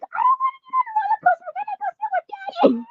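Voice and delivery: put-on very high fast childish whine; little girl begging to sit with Daddy; whiny little girl. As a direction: whine like a scared little kid. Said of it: "And I'm like".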